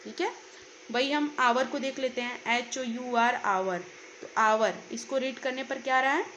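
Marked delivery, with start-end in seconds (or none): 3.4-3.8 आवर starts on the vowel, no h sound; silent h. Shown as a demonstration. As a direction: say आवर without the h. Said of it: "आवर में एच silent है". (आवर, h silent)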